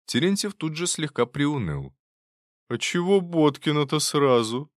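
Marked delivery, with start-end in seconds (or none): 2.7-4.7 higher-pitched, mildly dejected grumble; a touch of let-down protest; sighing complaint. A narrator's reading. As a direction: complain with a sigh in it. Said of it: "вздохнул он".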